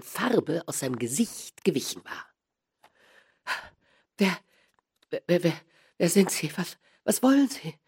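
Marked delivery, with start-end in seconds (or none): 4.2-7.7 halting, stammered questions rising higher; unsteady voice. A narrator's reading. Small man startled by a tap on the shoulder, faltering. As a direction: falter and repeat, startled and unsure. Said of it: "mit unsicherer Stimme".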